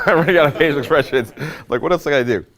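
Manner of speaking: real amused laughter in the voice; laughing while talking. Real, not acted. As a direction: crack up midway through admitting it. Cracks up during his own demo.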